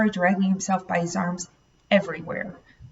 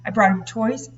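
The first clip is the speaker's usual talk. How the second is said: plain reading voice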